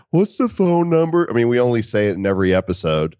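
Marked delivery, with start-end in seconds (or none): 0.1-1.2 higher-pitched imitation of a clueless listener; mock listener question. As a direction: mimic a listener asking the obvious. Mimics listeners asking repeatedly.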